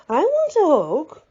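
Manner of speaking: high, loud, slow mock-whiny plea; playful man's voice. A narrator's reading. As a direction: beg for a hug like a big kid. Man asks playfully for hug.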